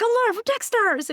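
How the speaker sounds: high, overeager self-introduction; hammed-up excited greeting; imitated yelling. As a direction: blurt it out like a manic fan. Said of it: "just yelling at him".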